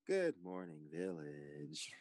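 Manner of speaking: drawn-out greeting, sinking low and soft; hushed wake-up call. Pretend. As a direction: let the last word fade into a whisper. Moderator redoes the wake-up greeting.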